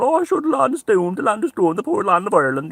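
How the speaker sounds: high, loud mock lament; weeping moaner. Mocking the rosary-bead moaners over Ireland, mock wailing. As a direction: wail it like a doom-laden rosary-beader. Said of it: "creeping, weeping mo-, rosary bead maulers going".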